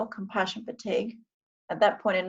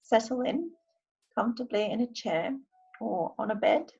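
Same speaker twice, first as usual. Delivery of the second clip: guided meditation; 6 semitones higher, 4 dB quieter than usual. Acted. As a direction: ease them gently into calm. I'm going to now do a short meditation.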